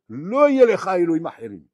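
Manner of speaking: loud, lofty proclaiming of the verse; God's command. Acted. Rabbi voicing God's commandment to Israel, loud and lofty. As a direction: boom out the commandment.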